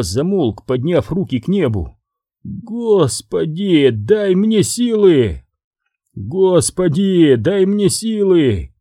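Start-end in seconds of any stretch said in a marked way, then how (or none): 2.4-5.4 slow, high, drawn-out plea to heaven; exasperated plea. Acted. Boss despairing over his henchman, slow high exasperated plea. 6.1-8.7 exasperated plea. Acted. Boss repeating his despairing plea, slow and high.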